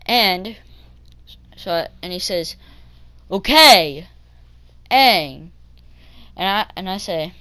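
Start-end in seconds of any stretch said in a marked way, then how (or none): 3.3-5.5 loud mock acknowledgement; imitated retort. Bus driver answering kid's no, loud imitated retort. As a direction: fire it back loudly as the driver.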